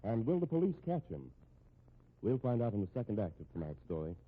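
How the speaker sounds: usual speaking voice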